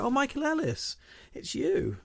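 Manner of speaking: high, cheery mock greeting; greeting shopkeeper. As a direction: chirp it like a delighted shopkeeper. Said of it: "they go".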